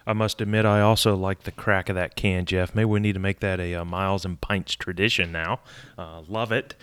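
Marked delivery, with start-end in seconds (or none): none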